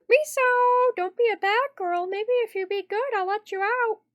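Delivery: high, loud, singsong chiding voice; scolding game character. Acted. Captor to Misao locked in, high chiding voice. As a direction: scold from high up, teasing with a promise.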